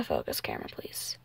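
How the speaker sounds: whispered aside off-mic; hushed request behind the scenes; a quick whisper to whoever holds the camera. Real, not acted.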